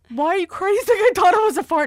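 high and loud laughing retelling; shrieking mock quote. Sister shrieking the family's reaction to her brother. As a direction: squeal it out in disbelief. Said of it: "we're like".

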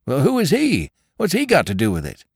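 high, then quick questions; eager drummer. Acted. Drummer questions the men, high and quick. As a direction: hurry the questions out. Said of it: "drummer asks".

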